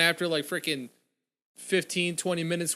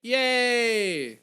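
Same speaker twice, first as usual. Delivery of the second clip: mock cheer; 8 semitones higher, 4 dB louder than usual. Real, not acted.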